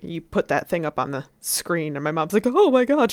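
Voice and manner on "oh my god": high, loud mock maternal shock; suddenly concerned mom. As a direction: imitate a mother's sudden horror. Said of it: "immediately changed her entire tune".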